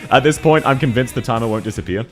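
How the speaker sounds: ordinary talk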